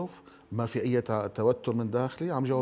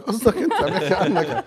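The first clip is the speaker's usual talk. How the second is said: loud, higher, laughing accusation; joking challenge; 6 semitones higher, 8 dB louder than usual. Acted. Guest teasing the panel about who is meant, laughing challenge. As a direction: tease them loudly through laughter.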